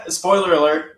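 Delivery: high, loud giggle through the words; laughing. Real, not acted. Guest laughs at the host who never finished the season. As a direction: laugh it out, amused.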